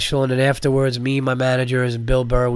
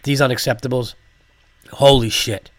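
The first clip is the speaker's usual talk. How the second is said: ordinary talk, nothing marked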